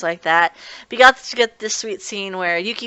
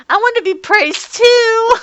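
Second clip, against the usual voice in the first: put-on much higher, slow childish voice; childish whine; 8 dB louder, slower than usual. Momiji begging to be praised too, in a high slow childish whine. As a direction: whine like a small kid who wants praise.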